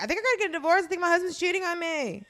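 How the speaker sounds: high, loud mock lament; upset wife. Retelling the drama wife suspecting her husband, hammed-up high distress.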